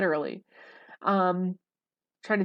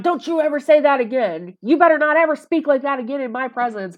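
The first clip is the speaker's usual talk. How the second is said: mock furious scolding, louder and higher; retold angry outburst; 6 semitones higher, 7 dB louder than usual. Ex-boyfriend scolding a friend for saying fam, mock furious. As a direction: bark the warning like an outraged ex. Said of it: "just completely went off on somebody".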